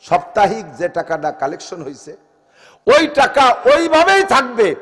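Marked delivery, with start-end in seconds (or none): 2.9-4.7 higher, louder and slow, leaning on ওইভাবেই; ওইভাবেই stretched and cried out; preaching emphasis. Acted. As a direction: hammer the rule home from the pulpit. Preacher repeats rule, raised.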